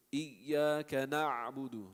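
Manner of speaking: Iyyaka na'budu slow with a firmer ayn in na'budu; slow recitation, firm ayn; stronger ayn. Shown as a demonstration. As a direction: press the ayn of na'budu harder. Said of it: "make it a little stronger".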